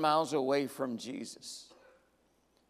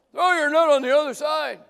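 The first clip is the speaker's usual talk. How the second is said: higher, louder call; yell from shore; 8 semitones higher, 7 dB louder than usual. Acted. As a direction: call out across the water. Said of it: "some guy on the shore yells".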